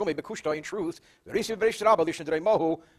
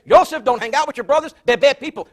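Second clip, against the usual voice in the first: high, loud storyteller's protest; exasperated scolding; 6 semitones higher, 9 dB louder than usual. Retold or imitated. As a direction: act out a fed-up woman's warning. Woman retells her ignored warning.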